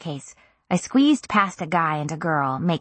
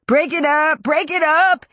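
put-on high, loud drunk shout; 9 semitones higher, 4 dB louder than usual. A narrator's reading. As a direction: bellow it like a frat guy. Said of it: "he shouted".

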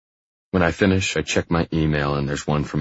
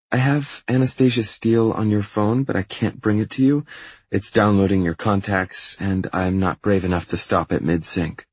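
higher-pitched female assistant voice; higher voice for a hesitant assistant; apologetic assistant; 4 semitones higher than usual. Acted. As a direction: report to the boss in a lifted, lighter pitch. Voices assistant Andrea on an errand.

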